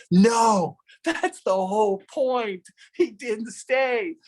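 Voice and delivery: lightly voiced exasperated family; exasperated correction. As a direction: spell it out to a clueless dad. Wife and daughter correcting him.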